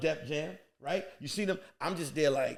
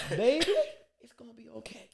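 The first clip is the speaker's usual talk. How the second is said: consoling whisper; 4 semitones higher than usual. Retold or imitated. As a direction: hush it like soothing a child. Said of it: "go like this".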